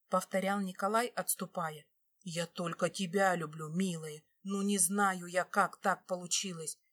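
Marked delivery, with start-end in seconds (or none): none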